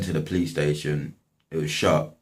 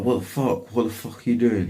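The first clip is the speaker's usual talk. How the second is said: higher-pitched retold outrage, not shouted; mock outraged freak-out; 6 semitones higher than usual. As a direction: imitate someone losing it, pitched up. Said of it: "He went absolutely mental".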